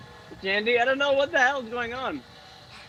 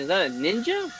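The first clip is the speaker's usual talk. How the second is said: usual speaking voice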